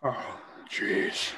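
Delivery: soft, breathy resignation; resigned. Acted. Mario giving in to Walton, quietly resigned. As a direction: give in with a quiet groan. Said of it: "Mario, resigned".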